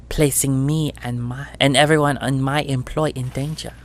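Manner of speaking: a hint of a nasal, mean auntie scolding her runners; rusty mean crime boss. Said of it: "voice is nasal and rusty mean".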